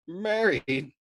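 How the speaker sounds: high, loud mocking disbelief; incredulous echo. Arfi mocking Nately's wedding plan, incredulous. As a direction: toss the word back, amused and amazed. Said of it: "Arfi's indulgent merriment swelled".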